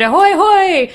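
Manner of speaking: high, loud booming foghorn call; foghorn impression. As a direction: sound it out like a foghorn blast. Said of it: "impression of it".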